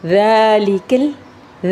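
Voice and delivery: slow "ذالک" with a gentle th-like ذ; drawn-out recitation, soft ذ in "ذالک"; soft dhaal. Shown as a demonstration. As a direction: ease into the ذ of "ذالک" and lengthen its aa. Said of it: "ذال کو ایک نرمی سے پڑھیں گے".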